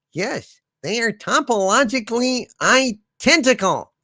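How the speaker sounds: high, odd put-on monster voice; cosmic horror voice. Voicing the cosmic horror's reply.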